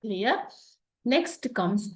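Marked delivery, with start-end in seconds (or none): none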